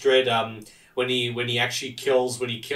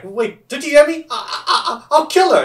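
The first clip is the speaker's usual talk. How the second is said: much higher, louder, frantic mock panic; a criminal losing it, yelling; panicked threat; 14 semitones higher, 7 dB louder than usual. Panicked hostage-taker threatening.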